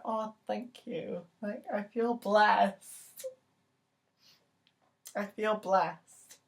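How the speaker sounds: high, slow squeal of gratitude; touched gushing. Retold or imitated. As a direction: play up being overcome, drawn out high. Gushing retold reply to barista.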